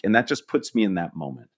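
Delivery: ordinary talk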